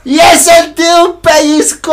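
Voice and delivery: high yelled cry of pain, drawn out; pained shout. Retold or imitated. Retelling the zipper pinch to his friend, a yelled cry. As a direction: yelp as if the zipper just bit.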